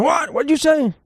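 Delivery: high, mock deaf old man; imitating a hard-of-hearing old man asking again. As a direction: crack it out like a grandpa who can't hear. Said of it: "I'm like an old man".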